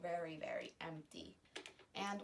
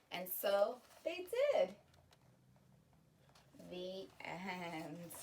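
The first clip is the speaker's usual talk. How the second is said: ordinary delivery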